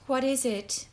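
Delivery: mildly fearful question; voice trembling. A narrator's reading. Prisoner asking about the unknown packet, voice trembling. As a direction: ask with a touch of dread. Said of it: "voice trembling".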